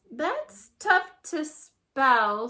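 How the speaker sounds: slow dry schoolboy remark; kid deadpan. A narrator's reading. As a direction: drag it out unimpressed. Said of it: "says Randy".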